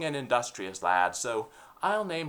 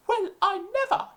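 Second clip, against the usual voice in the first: very high, affronted English lady; huffy posh lady taken aback; 4 dB louder than usual. Acted. English lady offended by the speaker, very high voice.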